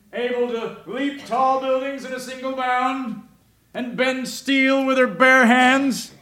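lightly voiced comic-book narrator, grandly; mock Superman announcer.

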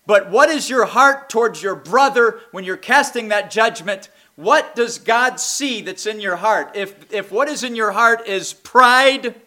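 impassioned preaching. Real, not acted. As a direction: press the congregation with searching questions. Preaches to the congregation.

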